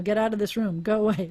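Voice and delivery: usual speaking voice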